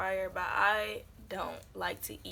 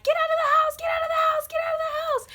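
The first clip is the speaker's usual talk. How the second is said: very high, loud re-enacted yell; urgent yelling; 9 dB louder than usual. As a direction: yell a frantic warning up to a brother. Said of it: "I'm yelling at him".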